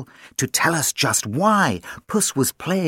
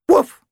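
a high, loud barked woof; a cheerful little dog's woof; playful woof; 6 dB louder than usual. Acted. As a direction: bark it like a small dog. Dog barks in story.

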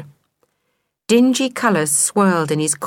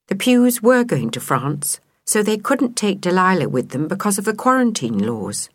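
usual speaking voice

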